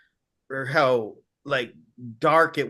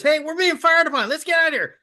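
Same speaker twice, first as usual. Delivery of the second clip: high, fast panicked radio call from the pilot; 13 semitones higher, 4 dB louder, faster than usual. Retold or imitated. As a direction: blurt it out in a panic as the shots hit. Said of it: "And the guy says".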